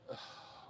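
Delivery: unvoiced-breath sigh of defeat; exasperated sigh. Real, not acted. At a loss over the process, exasperated sigh. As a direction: let out a weary sigh. Fed up with the guitar project.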